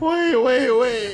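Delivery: loud, high-pitched calls to stop; urgent interruption. Real, not acted. Viewer halts the clip in disbelief, urgent. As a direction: cut in loudly and high.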